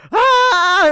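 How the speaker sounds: high shrieking mock scream; sudden high fake scream; mock horror scream. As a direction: shriek like a horror movie victim.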